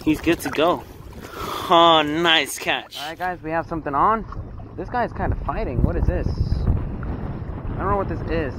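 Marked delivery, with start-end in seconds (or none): none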